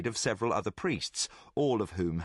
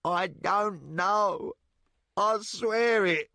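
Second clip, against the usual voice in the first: higher, slow pleading on the edge of tears; near tears; 8 semitones higher, 3 dB louder, slower than usual. Acted. Terrified thief pleads with the king, near tears. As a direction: beg slowly, voice about to break. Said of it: "Jack was near tears when he spoke".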